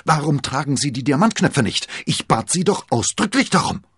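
loud, high, indignant demand; furious king berating the queen; angry rebuke. A narrator's reading. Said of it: "fuhr er die Königin an".

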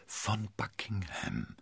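soft, slow, drawn out; quiet. A narrator's reading. Constanze confirming the Duke's secret identity, quiet. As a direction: say it softly and slowly. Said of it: "bestätigte Constanze leise".

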